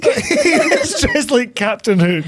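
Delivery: high, slow, helpless laughter through the words; laughed-out words; laughing. Real, not acted. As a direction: repeat the joke cracking up. Repeats friend's joke while laughing.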